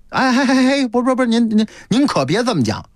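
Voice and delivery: high-pitched, stammering objection; flustered protest. Acted. Collector hushing his friend's boast, flustered and high. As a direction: rush in to stop him, pitched high.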